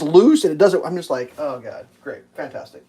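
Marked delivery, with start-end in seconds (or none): 1.4-2.8 very quiet, low muttering; resigned sarcasm. Retold or imitated. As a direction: mutter it under your breath, defeated. Weary sarcasm at broken mailbox.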